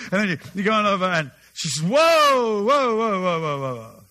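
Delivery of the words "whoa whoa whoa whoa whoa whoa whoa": firm warning call. Retold or imitated. Trainer stops owner approaching.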